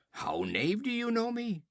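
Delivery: high, astonished question; amazed question. Acted. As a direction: ask in disbelief. Olgerd continues amazed.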